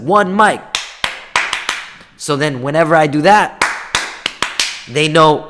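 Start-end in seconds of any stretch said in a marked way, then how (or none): 0.1-0.6 loud, high call for silence; classroom attention call. Retold or imitated. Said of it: "sometimes in a classroom I said".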